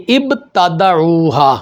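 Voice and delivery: ابتدعوها drawn out slowly, syllable by syllable; slow Quranic reading. Shown as a demonstration. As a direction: stretch ابتدعوها slowly as if reciting. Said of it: "آگے چلیے". (ابتدعوها کو آہستہ آہستہ کھینچ کر پڑھیں)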